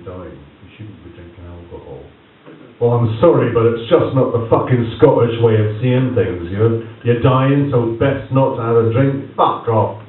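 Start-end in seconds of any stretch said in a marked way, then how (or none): 2.8-10.0 loud, exasperated Scottish outburst; loud indignant rant against the daughter-in-law. Real, not acted. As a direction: let rip at the absurd rule.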